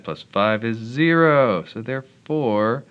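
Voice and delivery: loud, slow, drawn-out triumph; triumphant. Real, not acted. Tutor finds the root at last, triumphant. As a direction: stretch it out loud and high in victory.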